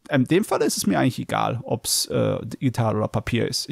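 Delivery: usual speaking voice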